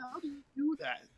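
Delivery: high, disbelieving squeak of a question; incredulous. Retold or imitated. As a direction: ask it in shocked disbelief. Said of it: "Yeah, I'm like".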